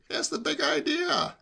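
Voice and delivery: high, drawn-out nosy question; insistent nephew. A narrator's reading. Nephew who will not drop it, insistent. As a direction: keep pestering, high and slow. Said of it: "persisted Hugo insatiably".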